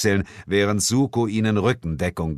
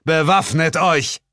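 call to arms; 7 semitones higher, 4 dB louder than usual. Acted. Hero calls to arms.

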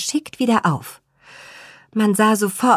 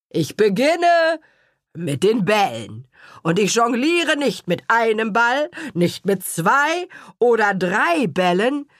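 lightly voiced showman father; 3 semitones higher than usual. Father boasting to audience.

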